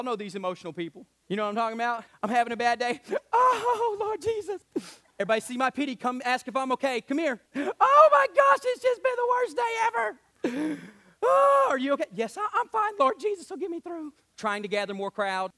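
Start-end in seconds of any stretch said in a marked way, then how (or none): 3.3-4.6 high, whiny pity-me voice; mock self-pitying churchgoer. 7.5-10.8 high, loud, slow mock wail; hammed-up wailing complaint. Mocking a self-pitying churchgoer. 11.2-12.1 high, loud fussing concern; mock sympathetic friend. Voicing a sympathizer. 12.1-14.1 higher, fake-brave reply; mock brave sufferer. Self-pitying churchgoer answering.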